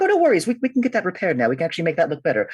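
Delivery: high, bright grateful townsfolk; cheerful high voice. Acted. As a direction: gush with relief.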